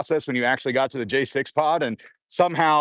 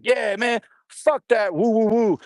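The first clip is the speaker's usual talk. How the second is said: higher, hooting crowd of inmates; rowdy inmate jeering; 9 semitones higher than usual. Retold or imitated. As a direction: whoop and jeer like a cellblock. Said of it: "And they're all like".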